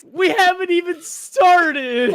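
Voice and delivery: slow, laughing and teary. Real, not acted. Laughing at crying friend.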